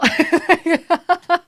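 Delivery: high loud laugh at herself; loud burst of laughter. Real, not acted. Friend laughing hard at her chipper blunder.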